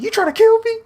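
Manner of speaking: high, loud squeal of disbelief; shocked protest. Retold or imitated. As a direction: yelp it like you were just poisoned. Retold shocked protest in story.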